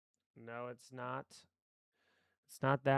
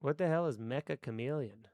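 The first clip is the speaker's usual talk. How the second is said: normal talking voice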